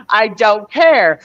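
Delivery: drawn-out, stubborn "I don't care"; a child refusing to back down; slow defiant. Retold or imitated. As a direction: say it slowly, like a kid digging in her heels.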